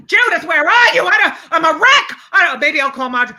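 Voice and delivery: high, loud, frantic mock panic; imitating hysterical mother. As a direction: ham up the mother's panic on the phone. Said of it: "she was hysterical".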